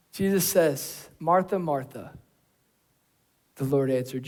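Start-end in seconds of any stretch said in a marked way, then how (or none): 1.2-2.2 gentle address. Acted. Jesus gently answering.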